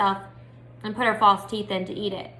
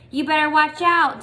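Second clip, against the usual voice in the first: loud, raised warning call; hollered warning; 5 semitones higher, 6 dB louder than usual. A narrator's reading. Dunlap hollering a warning at Opal on her bike. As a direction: shout the warning after her. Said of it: "Dunlap hollered".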